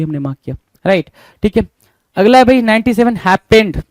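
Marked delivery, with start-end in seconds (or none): 3.2-3.8 हैपेंड said as "hap-PEND", louder; misspelling read out. Shown as a demonstration. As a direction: stress a full "pend" in हैपेंड. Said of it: "क्या बोल रहा है भाई?".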